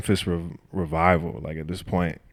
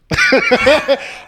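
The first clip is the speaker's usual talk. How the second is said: loud, high burst of laughter; 12 dB louder than usual. Real, not acted. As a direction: laugh hard at your own boast. Laughs after joking boast.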